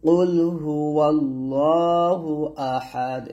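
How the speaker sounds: teacher reciting for students to repeat; Quran recitation. Shown as a demonstration. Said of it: "আবার বলেন".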